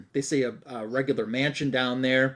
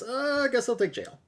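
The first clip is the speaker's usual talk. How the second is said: mock reluctant, higher-pitched giving in; 9 semitones higher, 4 dB quieter than usual. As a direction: lift the pitch and concede grudgingly. Mugger grudgingly accepts jail.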